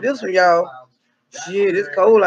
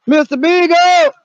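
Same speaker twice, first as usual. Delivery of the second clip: slow, loud, higher call; calling out the dog's name; 7 semitones higher, 6 dB louder, slower than usual. Pretend. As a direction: drag the name out across the woods. Calling the lost dog.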